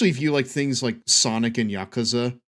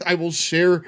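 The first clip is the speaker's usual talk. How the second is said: laughing; 6 semitones higher, 6 dB louder than usual. Real, not acted. Laughing at own joke.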